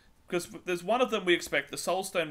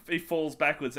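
ordinary talk, nothing marked

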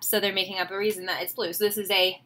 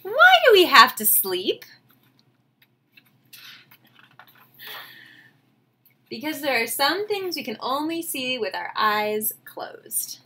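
storybook reading; 3 semitones higher than usual. A narrator's reading. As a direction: savor each line like a bedtime story. Teacher reads picture book aloud.

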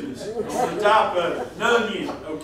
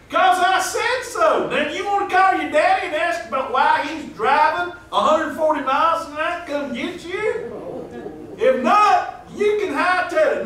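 mock stern scolding; 7 semitones higher, 4 dB louder than usual. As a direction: lay down the law, hammed up. Counselor scolding the boy.

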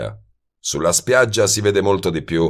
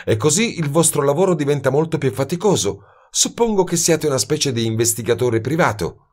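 sly; 8 semitones higher than usual. A narrator's reading. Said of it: "disse in tono astuto".

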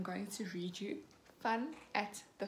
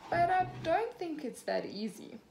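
lightly voiced stallholder, higher; a hint of a doubtful fairground lady; higher teasing stall voice; Miss Rabbit voice; 7 semitones higher, 4 dB louder than usual. A narrator's reading. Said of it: "Miss Rabbit voiced".

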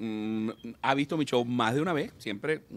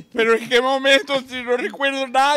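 high, loud disbelieving squeal; high panicky drunk asking when he died; shocked drunk; 10 semitones higher, 4 dB louder than usual. Acted. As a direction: yelp it out, can't believe he died. Dead drunk shocked in heaven.

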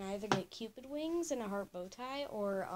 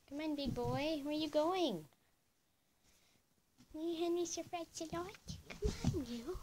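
pet talk; 9 semitones higher, 6 dB louder than usual. Real, not acted. Calling pet Henry over, high loud cooing. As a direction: coax the pet up high and bright.

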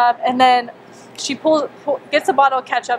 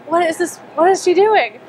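higher-pitched exasperated retold questions; frustrated, upset; 6 semitones higher than usual. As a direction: relive your disbelief at what she's doing. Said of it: "So I'm getting so frustrated, so upset".

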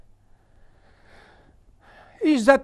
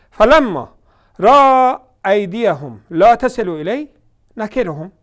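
slow, louder chanted recitation; Quran recitation; 8 dB louder, much slower than usual. Real, not acted. Preacher reciting the verse to the audience, slow and loud. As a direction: intone the verse slowly and fully.

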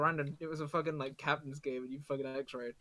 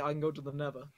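usual speaking voice

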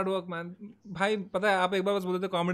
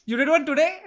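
surprised; 5 semitones higher, 8 dB louder, slower than usual. Real, not acted. Host surprised by guest's news.

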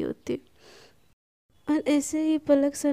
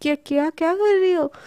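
stuttering, startled question; acted nervous stutter; flustered stammer; 2 semitones higher, 4 dB louder than usual. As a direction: trip over the first word in shock. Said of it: "एकदम हकला के सना बोलती है".

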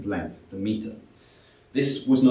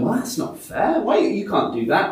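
protesting peasant; 9 semitones higher, 3 dB louder than usual. Retold or imitated.

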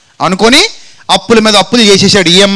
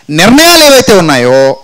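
normal talking voice

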